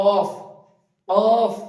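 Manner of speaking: অফ loud with the tongue back on the palate; deep Arabic qaf. Shown as a demonstration. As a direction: knock অফ hard at the back of the mouth. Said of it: "উপরের তালুর সঙ্গে লাগিয়ে উচ্চারিত হবে". (জিভের শেষ ভাগ তালুতে লাগিয়ে জোরে অফ)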